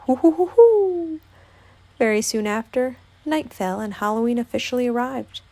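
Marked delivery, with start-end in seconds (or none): 0.1-1.2 high, loud hooting chuckle; drawn-out cartoon "hoo-hoo" laugh; Tigger's hooting laugh. A narrator's reading. Tigger winking at Piglet, hooting with laughter.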